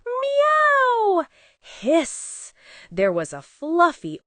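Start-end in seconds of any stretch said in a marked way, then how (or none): 0.1-1.3 long high falling meow; loud drawn-out meow; loud cat meow. Acted. A terrible sound. 1.6-2.5 short cat hiss. Acted. A terrible sound.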